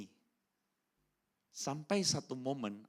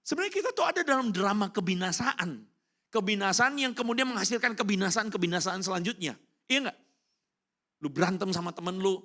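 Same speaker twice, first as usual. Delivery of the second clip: raised, higher preaching voice pressing the point; 6 semitones higher, 6 dB louder than usual. Acted. Preacher rousing the congregation, raised voice. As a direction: drive the message home to the crowd.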